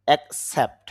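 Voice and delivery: Accept with the stress on the Sept part; stress on second syllable. Shown as a demonstration. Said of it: "সেফের মধ্যে একটু চাপ দিতে হবে". (অ্যাকসেপ্ট-এর Sept অংশে চাপ)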